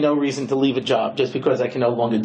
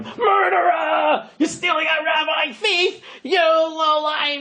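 high, slow drawn-out yells; screaming insults; 11 semitones higher, slower than usual. Retold or imitated. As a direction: jeer at the thief from the roadside. Screaming at him.

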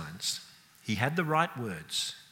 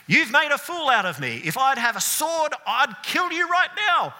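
much higher, louder mock rage; furious Balaam; 14 semitones higher, 7 dB louder than usual. Balaam raging at his donkey in front of princes, mock fury. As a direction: rant at the donkey like a humiliated man. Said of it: "a good piece of his mind".

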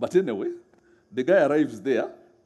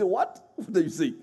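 shocked disbelief; 3 semitones higher than usual. Retold or imitated. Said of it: "you look at them and say".

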